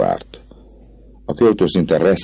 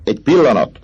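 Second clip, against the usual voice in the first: high, louder vagabond king; Clopin calling out; 11 semitones higher, 4 dB louder than usual. A narrator's reading. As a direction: stop everyone with a sudden call. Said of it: "szólt". (hirtelen felkiáltó Clopin)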